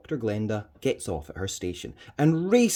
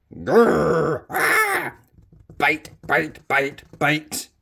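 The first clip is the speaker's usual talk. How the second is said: growling cat attack; 6 semitones higher, 8 dB louder, much slower than usual. Acted. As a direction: growl then high yelp, loud chant of bites.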